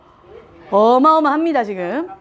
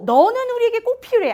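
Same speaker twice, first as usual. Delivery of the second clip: tender praise; 9 semitones higher than usual. Shown as a demonstration. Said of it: "제일이란 말을 꼭 해주셔야 됩니다".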